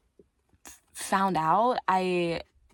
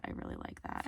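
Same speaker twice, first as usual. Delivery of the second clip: whispered; 15 dB quieter than usual. Real, not acted. Whispered repeat.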